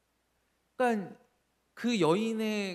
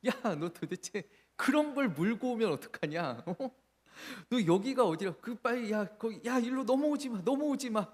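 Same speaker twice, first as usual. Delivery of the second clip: retold startled yell, higher; yelling at the cat; 6 semitones higher than usual. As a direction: shoo the cat away with a sharp yell. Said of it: "막 제가 막 소리를 막 질렀어요".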